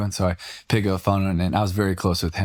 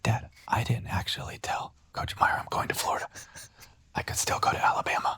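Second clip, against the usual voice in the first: panicked hushed whisper; 8 dB quieter than usual. Retold or imitated. Whispering confession to dad before going live, hushed. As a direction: breathe it out secretly, no voice.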